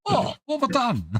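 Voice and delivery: high, far voice; panicked dismay. Retold or imitated. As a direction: play the horrified realization. Retelling own panicked reaction.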